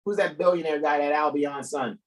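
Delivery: ordinary talk, nothing marked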